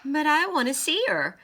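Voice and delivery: put-on high, loud childish protest; little girl protesting to her friend. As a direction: plead it high like a whiny kid. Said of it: "Elise protested".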